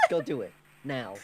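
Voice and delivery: ordinary delivery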